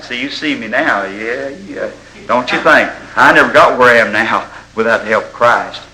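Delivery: ordinary delivery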